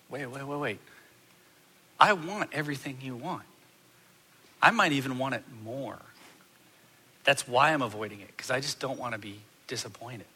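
soft, earnest, slowing her down; sincere reassurance. Retold or imitated. Husband reassuring his upset wife, soft and sincere. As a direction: gently confess the fear underneath.

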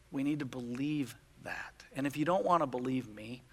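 low, soft, solemn sermon voice; earnest preaching. Real, not acted. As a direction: bring it down to a hushed, weighty exhortation. Solemn preaching after the story.

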